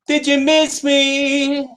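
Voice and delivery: high, stretched-out tease; playful drawn-out greeting. Pretend. Host back on camera teasing viewers, playful drawn-out call. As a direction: draw out "miss me" high and long.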